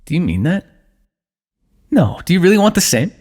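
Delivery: ordinary delivery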